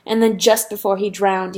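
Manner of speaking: ordinary delivery, nothing marked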